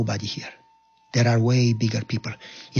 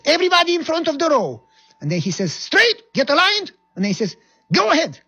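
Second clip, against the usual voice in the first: much higher and louder parade commands; barked orders; 13 semitones higher, 4 dB louder than usual. Retold or imitated. Lieutenant commanding the soldiers in the row, loud barked orders. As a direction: shout it down the line.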